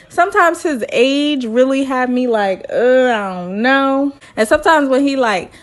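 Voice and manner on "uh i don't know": slow, unsure "uh" stretched out; doubtful hesitation. Retold or imitated. Reacting to Zach's age, a slow doubtful hedge. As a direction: hedge like you're not convinced. Voicing own doubt about Zach.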